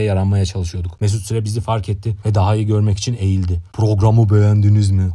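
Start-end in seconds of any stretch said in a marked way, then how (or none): 3.7-5.1 booming, drawn-out giant voice; hammed-up giant presenter; giant's speech. A narrator's reading. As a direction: boom it out like a giant radio host. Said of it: "Dev konuşmasıyla yaptığı için".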